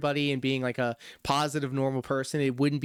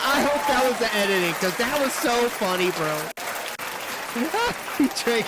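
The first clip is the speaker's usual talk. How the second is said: laughing; 8 semitones higher than usual. Real, not acted. Laughing after crash.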